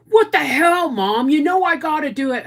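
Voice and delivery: put-on high, loud bratty teenage protest; mimicking whiny teen son. Son protesting to his mom in the waiting room, high and bratty. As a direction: squeal it like an outraged seventeen-year-old.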